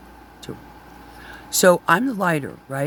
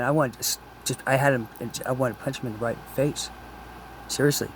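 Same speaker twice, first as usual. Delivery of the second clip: ordinary talk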